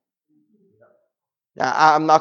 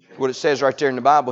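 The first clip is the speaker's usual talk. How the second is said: ordinary delivery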